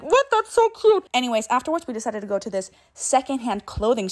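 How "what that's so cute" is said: excited squeal. Retold or imitated. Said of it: "And I was like".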